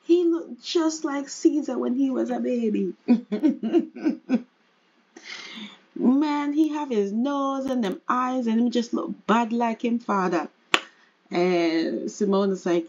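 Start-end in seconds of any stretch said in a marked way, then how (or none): 0.0-2.9 higher-pitched Jamaican grandma; Jamaican accent. Pretend. Grandma admiring her grandson, higher Jamaican accent. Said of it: "you know, they're Jamaican". 5.9-10.5 higher, playful Jamaican grandma voice; Jamaican accent. Pretend. Grandma comparing baby to his father, put-on Jamaican accent. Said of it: "you know, they're Jamaican".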